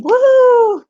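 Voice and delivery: high loud whoop of joy; excited cheer. Real, not acted. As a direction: cheer it out big. Cheers weight.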